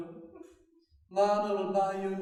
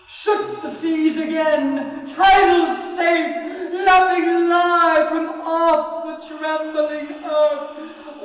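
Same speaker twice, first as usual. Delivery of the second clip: booming, slowed chant swelling higher and louder; loud declaiming; 7 semitones higher, 8 dB louder than usual. Acted. The mourner hurling the verse out at full force. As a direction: proclaim it like a tide rising over the earth. Chanting lines about the seas.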